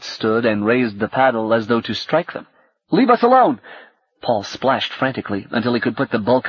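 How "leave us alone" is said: loud, high panicked cry; frightened shout. A narrator's reading. Paul shouting at the creature raising its paddle, panicked. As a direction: yell to fend off the creature.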